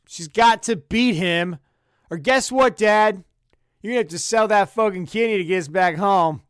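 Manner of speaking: loud, mocking jab at Dad; mock taunt. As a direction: rub it in, loud and gleeful.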